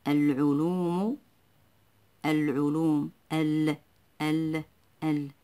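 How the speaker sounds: العلوم with a clear, held l in ال; pronounced lam. Shown as a demonstration. Teacher showing the moon lam, العلوم with l clearly sounded. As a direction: sound out the l of ال in العلوم, then isolate it. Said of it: "تنطق".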